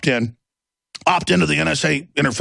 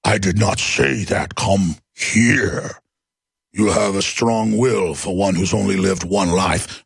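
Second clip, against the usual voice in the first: much lower, slow hypnotic vampire voice; low slow Dracula, mocking a critic; Count Dracula impression; 7 semitones lower, slower than usual. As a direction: lure him in like a vampire count. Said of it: "Like Count Dracula to Von Helsing".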